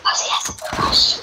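teasing whisper aside. Real, not acted. Friend whispering a tease about Ozzy, unvoiced whisper. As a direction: breathe it out like a secret.

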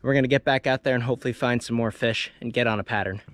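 ordinary delivery

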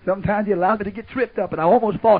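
usual speaking voice

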